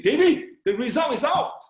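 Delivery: excited friend bringing news; announcing news. Retold or imitated. Friend bursts in with exam news.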